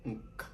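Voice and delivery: "nk" said as a nasal n plus k; sound nk. Shown as a demonstration. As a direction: say "nk" with the tongue back, ending in a crisp k. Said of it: "nous prononçons comme".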